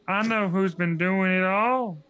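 slow, loud stage whisper, voiced; Lindy, conspiratorial. A narrator's reading. Sister revealing a secret to Chris, slow ominous voice. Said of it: "Lindy whispered".

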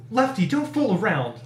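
mild narrated irritation; snapped warning. A narrator's reading. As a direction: snap it impatiently. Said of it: "I snapped".

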